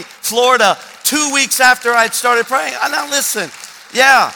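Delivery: loud, high, punched storytelling; forceful pulpit delivery, easing at the end; preaching full force. Real, not acted. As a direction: proclaim the miracle to the whole room.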